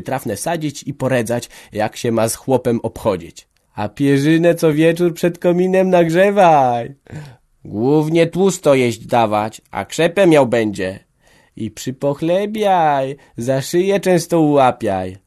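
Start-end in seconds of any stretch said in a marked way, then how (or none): 3.7-15.2 loud, higher, slow bawdy advice; teasing village women. A narrator's reading. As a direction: hand out bawdy tips, cackling. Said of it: "Jedna po drugiej prawiły". (głośne, wyższe, wolne sprośne rady kobiet)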